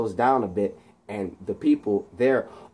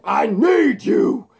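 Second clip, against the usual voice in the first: high, drawn-out shout; retold desperate yell; 10 semitones higher, 4 dB louder, much slower than usual. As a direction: yell it out as Electro, stretching the words. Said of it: "when he yells".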